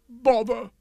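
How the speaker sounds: peeved king; vexed. A narrator's reading. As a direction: burst out in royal annoyance. King's cross reply about marmalade.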